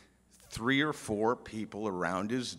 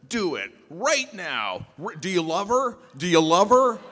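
high, loud needling questions; pushy demand; 6 semitones higher, 8 dB louder than usual. Retold or imitated. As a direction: push him into proposing. Ken pressuring at the party.

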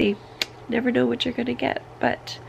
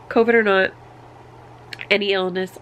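ordinary talk

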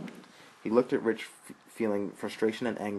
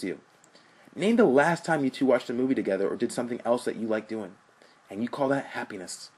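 defiant rant; 5 semitones higher, 4 dB louder than usual. Acted.

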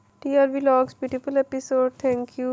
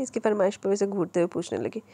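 ordinary delivery, nothing marked

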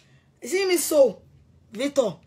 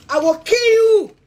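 much higher, louder, slow roar; furious shout; 9 semitones higher, 7 dB louder, slower than usual. Real, not acted. Screaming a death threat at Victor, slowly. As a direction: bellow the threat with everything.

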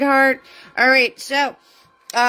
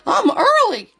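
excited laughing boast; 10 semitones higher, 5 dB louder than usual. Real, not acted. Proud of arriving early to stream.

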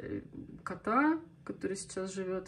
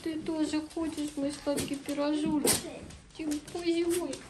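high, cooing voice for a dog; 8 semitones higher, 5 dB louder than usual. Real, not acted. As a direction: coo at the pet sweetly. Baby talk to the dog.

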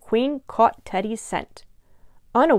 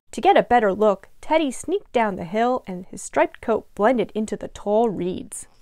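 ordinary delivery, nothing marked

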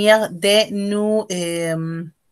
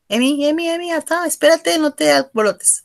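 calling out; 7 semitones higher than usual. Real, not acted. Teacher calms an eager student.

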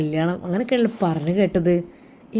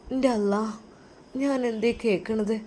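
fainting whisper; 8 dB quieter than usual. Acted. Mother fainting.

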